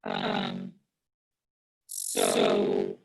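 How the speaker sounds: distorted, buzzing audio through a fan. Real, not acted. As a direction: voice garbled by fan noise. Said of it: "it sounds like you're speaking into a fan".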